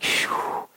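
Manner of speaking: unvoiced blown-out sigh of relief; soft exaggerated "phew"; relieved whew. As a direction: blow out the tension. Crowd relieved the ark is saved.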